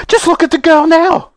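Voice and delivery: very high, frantic exclamation; distressed cry. Acted. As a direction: cry out in motherly horror. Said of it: "cried Missus Beauregard".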